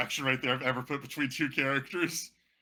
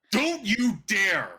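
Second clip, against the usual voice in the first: loud, slow, high indignant cry; outraged shout; 9 semitones higher, 11 dB louder, much slower than usual. Acted. Vain lord indignant at the wrinkle jab, shouting. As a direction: shout it out in shock.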